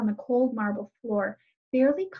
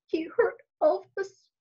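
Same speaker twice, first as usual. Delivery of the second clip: put-on slow, high, tearful little girl; sobbing; 8 semitones higher, slower than usual. A narrator's reading. Little girl over her bleeding dog, slow sobbing. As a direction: choke it out through tears. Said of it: "the little girl sobbed".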